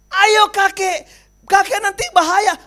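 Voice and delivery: pleading call. Acted. Rescuer pleading.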